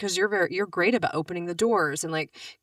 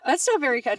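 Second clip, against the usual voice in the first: higher, louder and fast, hammed-up hurt; mock offended joke; 8 semitones higher, 5 dB louder than usual. Teasing her husband, she plays mock offended. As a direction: play-act being wounded with a big pitch jump.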